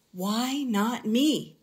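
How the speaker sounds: ordinary delivery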